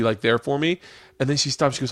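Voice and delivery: normal talking voice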